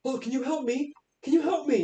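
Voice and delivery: high, pleading voice of a lost man; high voice of a naked stranger lost in the woods, asking for help. Acted. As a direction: beg them for help in a thin high voice. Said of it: "he's like".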